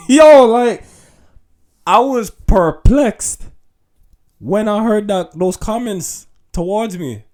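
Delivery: high and loud disbelief; excited. Real, not acted. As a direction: tell it all worked up.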